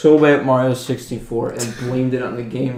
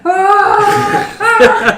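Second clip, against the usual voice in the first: high squealing laugh; laughing shriek; 7 dB louder than usual. Real, not acted. Laughing at the slip.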